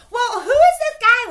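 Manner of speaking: high, loud nosy shout; sister screaming. Retold or imitated. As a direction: yell it, dying to know. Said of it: "screaming at each other in the bathroom".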